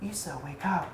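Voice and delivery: soft, hushed wake-up whisper. A narrator's reading. Wife waking her husband at dusk, softly whispering. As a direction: murmur it close to his ear.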